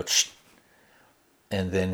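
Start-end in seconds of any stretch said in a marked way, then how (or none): 0.0-0.3 short imitated spurt of water; squirt gun noise. Imitating Bill's squirt gun as the trigger is pulled, squirting noise. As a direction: make a hissy squirt sound. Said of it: "you hear it kinda go".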